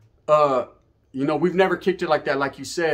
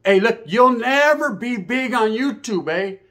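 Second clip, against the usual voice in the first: mocking cousin; 6 semitones higher, slower than usual. Mocking his cousin's put-down.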